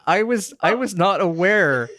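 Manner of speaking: high, slowed-down, incredulous; stunned disbelief. Real, not acted. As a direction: pitch shoots way up in real shock. Shocked by ghost possession news.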